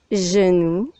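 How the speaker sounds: ordinary talk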